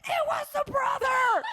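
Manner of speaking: very high, slow, loud shriek; shouting accusation. Retold or imitated. Friend screaming at the JonBenet documentary, shrieking. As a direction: yell the answer at the TV like a fan. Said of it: "going off".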